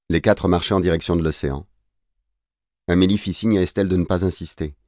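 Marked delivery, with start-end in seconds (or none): none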